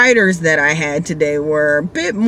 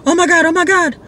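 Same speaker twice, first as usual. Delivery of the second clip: freaking out; 13 semitones higher than usual. Retold or imitated. Drunk rider panics at the police roadblock, high and frantic. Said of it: "he starts freaking out in the car".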